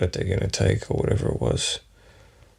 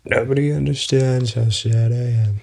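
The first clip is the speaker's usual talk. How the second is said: sad Trump impression; 6 semitones higher than usual. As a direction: play a whiny, self-pitying Trump, much higher than your own. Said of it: "I can't fucking do the impression".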